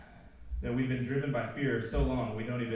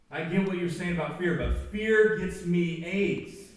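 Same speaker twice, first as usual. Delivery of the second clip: high, louder pleading student; defensive student voice; 4 semitones higher, 4 dB louder than usual. Retold or imitated. Anxious student defending fear to the preacher. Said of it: "They're just like".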